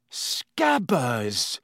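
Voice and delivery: blank, unexcited naming. A narrator's reading. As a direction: say it blankly, as if not taking it in. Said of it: "said Ron blankly".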